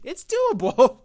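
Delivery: a hammed-up triumphant yell; mock shout. Shown as a demonstration. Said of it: "It's doable, just like me".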